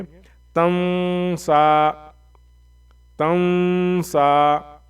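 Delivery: slow chant-like तन सा, न hidden in a nasal hum; nasal hidden noon. Shown as a demonstration. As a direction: stretch the न of तन सा through the nose. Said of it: "तन सा के बदले में कैसे पढ़ेंगे".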